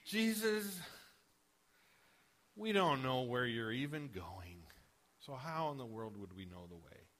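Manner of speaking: scoffing, exasperated disciple; frustrated sarcasm. Retold or imitated. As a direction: roll your eyes at Jesus as you ask. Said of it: "Exactly with that tone of voice".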